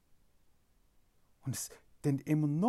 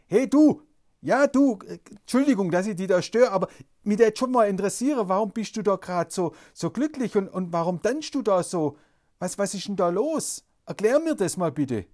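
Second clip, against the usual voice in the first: calling out; 4 semitones higher, 7 dB louder than usual. Acted. Calling out to dancing man.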